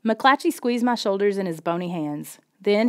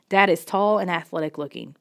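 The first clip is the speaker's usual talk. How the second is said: ordinary delivery, nothing marked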